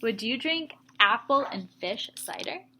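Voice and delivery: ordinary talk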